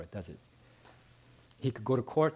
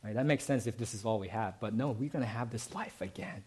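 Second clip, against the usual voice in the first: ordinary talk, nothing marked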